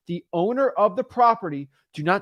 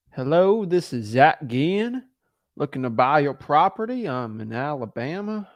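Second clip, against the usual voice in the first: bad Southern accent; 2 semitones lower than usual. Shown as a demonstration. Cold caller mocking up an Alabama pitch, bad Southern accent. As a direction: put on a poor Southern accent, drawing out the name. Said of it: "I have a bad Southern accent".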